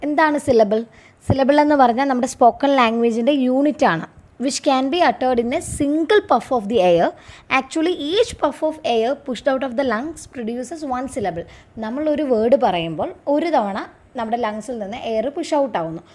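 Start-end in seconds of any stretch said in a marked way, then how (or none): 4.4-11.4 slow, measured reading of an exam question for students; slow dictation reading. Acted. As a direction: read it out slowly, word by word, like dictating to a class. Reads definition.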